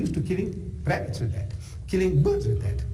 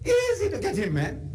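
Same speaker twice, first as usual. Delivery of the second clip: lightly voiced boy mocking, rising higher; a hint of a cocky shepherd boy; scornful shepherd boy; 5 semitones higher than usual. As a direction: sneer at the clumsy giant. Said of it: "He says".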